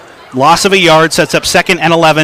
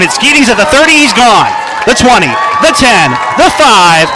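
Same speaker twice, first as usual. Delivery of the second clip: touchdown call; 6 semitones higher than usual. Real, not acted. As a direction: yell it as he races to the end zone. Live long touchdown pass call.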